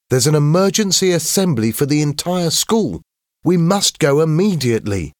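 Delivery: put-on high, urgent boy's voice; urgent schoolboy. A narrator's reading. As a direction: announce urgent news as an excited schoolboy. James's voice in story.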